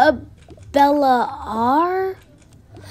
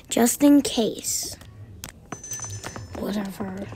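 usual speaking voice

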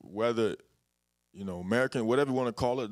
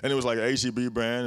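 ordinary delivery

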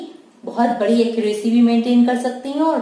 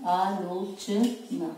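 slow dictation; 2 semitones lower, 6 dB quieter, much slower than usual. Shown as a demonstration.

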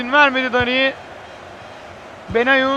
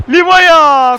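screaming football commentator; 6 dB louder than usual. Real, not acted. Penalty goal called.

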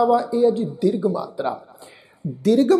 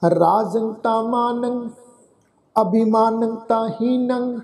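slow recitation; slower than usual. Shown as a demonstration. Recites verse slowly.

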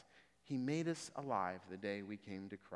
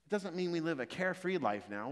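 higher, louder, impassioned sermon delivery; preaching at full force; 8 semitones higher, 4 dB louder than usual. Acted. Preacher urging the congregation about their past, impassioned preaching. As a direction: rise up and preach it to the back row.

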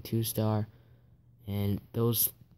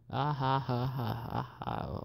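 mock laugh; 7 dB quieter than usual. Gamer quietly laughing at a rival's failed attack. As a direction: chuckle at them quietly. Mocking a bad base.

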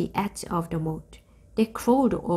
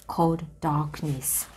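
ordinary delivery

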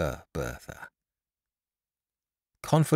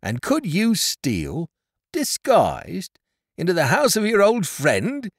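higher, deliberate accusing question; pained reproach; 6 semitones higher than usual. A narrator's reading. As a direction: confront an old friend with the betrayal. Carrier confronts Edward over deception.

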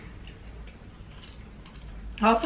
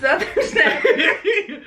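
high, slow, laughing self-imitation; retold line dissolving into laughter; laughing quote; 8 semitones higher, much slower than usual. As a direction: drag it out high through giggles. Quoting herself, cracking up.

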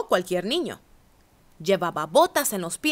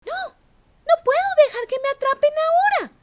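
high, fast, louder cry; urgent cry; 4 dB louder, faster than usual. A narrator's reading. Gingerbread cowboy refusing the coyote, fast high cry. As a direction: blurt it out in a panic. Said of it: "gritó el vaquero de jengibre".

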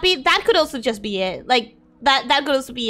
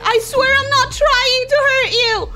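comforting apology; 6 semitones higher than usual. Real, not acted. Comforting hurt companion Jasper.

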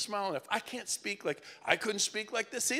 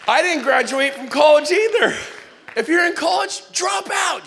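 loud, higher rally cry to the room; shouting to the audience to drop out; rousing shout; 8 semitones higher, 12 dB louder than usual. Real, not acted. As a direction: fire the crowd up, full voice. Rousing the crowd about college.

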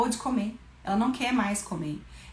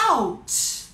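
drawn-out ouch. Shown as a demonstration. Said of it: "quando machuca".

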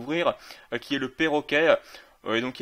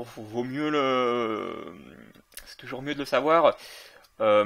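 ordinary talk, nothing marked

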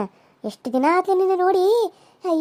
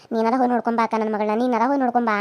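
lower, softer pleading mother's voice; quoting the girl's mother; 7 semitones lower, 4 dB quieter than usual. Retold or imitated. Visitor retelling what the girl's mother asked, lower and softer. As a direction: lightly voice a mother begging. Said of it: "ಅವ್ರವ್ವ ಏನಂದ್ಲು".

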